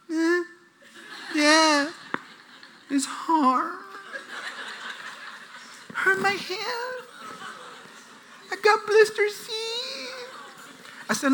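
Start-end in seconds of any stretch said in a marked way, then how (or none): 0.1-10.1 soft, high, drawn-out whimper; mock pitiful whine climbing higher; whining kid. Sons complaining to dad about raking leaves, mock whine. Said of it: "they said it like this".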